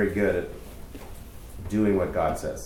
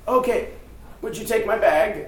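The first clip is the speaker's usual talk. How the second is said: lady's request; 10 semitones higher than usual. Retold or imitated. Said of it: "she's like".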